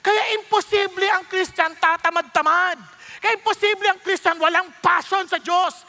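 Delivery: high, loud, impassioned preaching; full-force preaching. Acted. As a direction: drive the congregation to passion.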